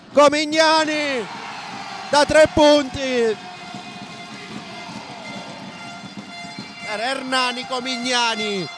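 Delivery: rising, excited play-by-play call; breathless italian goal commentary. Real, not acted. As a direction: build up as the three drops in. Three-point shot called live.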